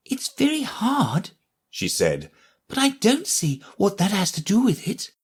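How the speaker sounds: put-on high, light girl's voice; young Alice. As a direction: voice Alice as a curious young girl.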